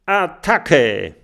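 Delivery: lauter, hoher Schlachtruf; Kampfruf. Acted. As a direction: ruf zum Angriff, mit voller Kraft. Kapitelauftakt, Kampfruf. (lauter Angriffsschrei)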